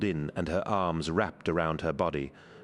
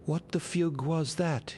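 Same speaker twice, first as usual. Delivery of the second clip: high, startled and groggy; snapping out of a trance, confused; dazed waking voice; 12 semitones higher than usual. A narrator's reading. Sonika waking from mind control, bewildered and high.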